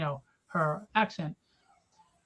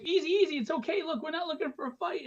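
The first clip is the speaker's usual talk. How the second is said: higher-pitched placating imitation; mock nervous appeasing, pitched up; scared backing down; 9 semitones higher, faster than usual. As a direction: imitate scared guys talking someone down. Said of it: "they're all scared and they're like".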